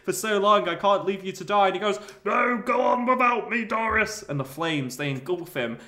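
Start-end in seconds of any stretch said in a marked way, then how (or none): 0.0-1.7 higher mock plea through laughter; laughing plea. Doris pleading with Bean, breaking into laughter. 2.2-4.2 higher, louder noble plea; hammed-up sacrifice. Trapped Bean sending Doris away, hammed-up.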